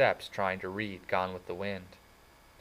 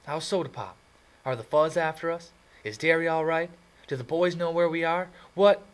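high, louder, rushing questions; desperate for news from home; eager questions; 9 semitones higher, 6 dB louder than usual. Acted. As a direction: blurt it all out at once, higher and louder.

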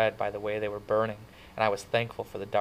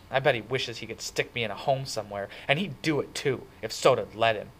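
louder, bitter and resentful, venting about his brother; bitter outburst; 2 semitones higher, 3 dB louder than usual. Acted. Ponyboy bursts out to Cherry about Darry, bitterly. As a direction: spit it out bitterly, hurt underneath. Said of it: "I burst out bitterly".

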